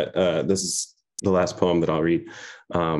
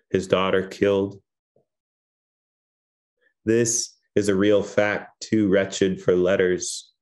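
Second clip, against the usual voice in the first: poem reading; 3 semitones higher than usual. Acted. Poet reading her last poem to the audience, hushed recitation. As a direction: give every image its own breath.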